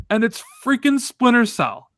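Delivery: upset. Real, not acted. Upset about no Splinter Cell.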